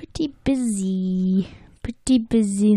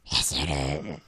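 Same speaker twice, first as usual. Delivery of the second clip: troll voice; 6 dB quieter than usual. Pretend. Teasing that Scots sound like trolls.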